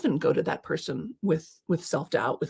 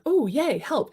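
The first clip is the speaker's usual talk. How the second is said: bright mock delight, pitched up; mock cheer; 8 semitones higher, slower than usual. Coach voicing a listener's mock glee about needing help, high and bright.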